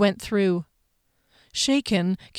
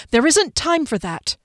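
higher-pitched, urgent objection; urgent protest; 7 semitones higher than usual. A narrator's reading. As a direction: cut off the idea with urgency.

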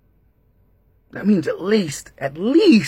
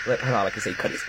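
ordinary talk, nothing marked

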